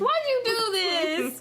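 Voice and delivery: choking back tears; tearful. Real, not acted. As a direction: voice breaking, slow and high. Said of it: "I'm trying not to cry".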